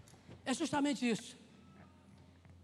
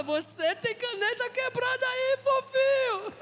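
high, loud, drawn-out teasing call; mock taunt sung out at full voice; playful shout; 10 semitones higher, 10 dB louder, slower than usual. Preacher getting people to tease their neighbour, playful shouting. Playful line for congregation to repeat.

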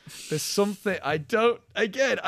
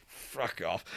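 much lower and softer, imitated; low dismissive retort; 9 semitones lower, 11 dB quieter than usual. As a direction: drop the voice for a mock brush-off. Acted quote of curt film reply.

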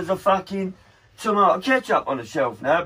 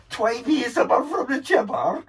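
put-on Scottish accent; 7 semitones higher than usual. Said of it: "She went".

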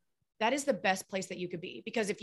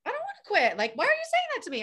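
indignant client; 12 semitones higher, faster than usual. Retold or imitated. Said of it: "And then they're like".

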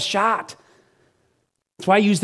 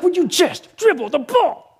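higher, laughing, pleading; mock exasperated parent; exasperated dad; 11 semitones higher, slower than usual. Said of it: "I was like".

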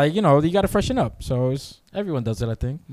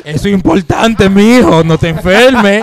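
louder Dominican mom voice; loud, fussing Dominican mother; Dominican accent; 3 semitones higher, 7 dB louder than usual. Retold or imitated. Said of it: "said it in a Dominican accent".

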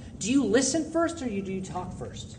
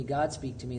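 usual speaking voice

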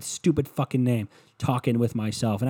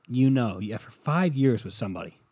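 normal talking voice

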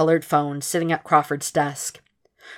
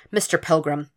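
plain reading voice

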